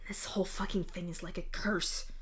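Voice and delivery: hushed, bitter whisper. Acted. Sophia to herself about the curse, whispered. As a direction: breathe it out like a muttered curse.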